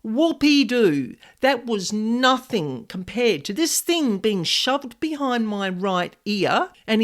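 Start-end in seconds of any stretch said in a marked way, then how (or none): none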